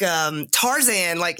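ordinary talk

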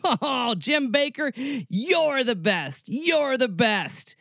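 laughing, drawn-out mock admiration; sarcastic praise. Real, not acted. Sarcastic praise before "being the worst.

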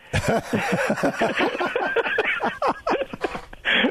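long high-pitched laugh. Real, not acted.

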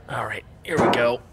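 hushed whisper, sneaking; breathy sneaking whisper. Acted. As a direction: whisper it so the terrorists can't hear. Sneaking into vents from terrorists.

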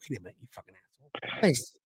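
deep muttered grumble, fast; low growly mock Walter. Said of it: "Walter's like".

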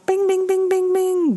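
high sung chime sounds; bell-like bings. Retold or imitated. Participants chiming in, imitating a high ringing bell. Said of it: "People are like".

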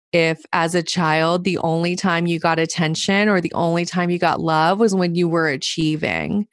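usual speaking voice

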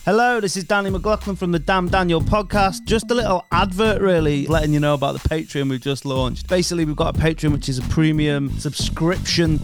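calm, steady advert voice; ad read. Acted. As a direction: pitch the Patreon to listeners. Reads Patreon advert.

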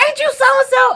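high-pitched mock holler, no real force; imitated yelling. Parking lot stranger pesters the speaker, lightly mocked yelling. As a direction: mimic a man hollering a question. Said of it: "like, yelling".

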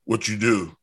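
lightly voiced aggressive wrestler; a hint of gruff interrogation, imitated; mildly confrontational mock question; Ron Simmons impression. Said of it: "he would talk to you so aggressively".

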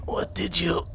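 barely conscious. Acted. Wounded kid coming back to consciousness, weak and faltering. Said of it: "barely there".

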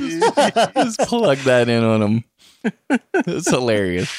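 high, drawn-out laughing delivery; cracking up while speaking. Real, not acted. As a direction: talk through real laughter at your own prank. Laughing at his own prank clip.

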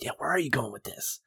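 soft, eager, egging on; eager viewer. Retold or imitated. Viewer cheering the scene on, soft and eager. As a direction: lean in, hooked and curious. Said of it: "I'm like".

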